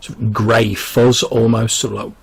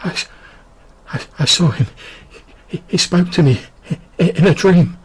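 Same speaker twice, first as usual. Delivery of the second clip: breathless and weak, gasping between words; a frail woman gasping for air; soft gasping voice; 8 semitones higher than usual. A narrator's reading. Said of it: "in a soft voice that's, um, still sort of gasping for air".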